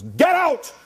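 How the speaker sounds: bishop's high, loud furious yell; furious shout. Retold or imitated. Bishop Sheen throwing the hippie out, furious and shouting. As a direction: roar him out of the sacristy. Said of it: "Turns around, he goes".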